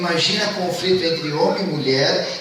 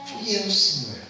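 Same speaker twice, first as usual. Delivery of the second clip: meek confession; 5 semitones higher, slower than usual. Acted. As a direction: confess timidly to the Lord. Woman confessing to God.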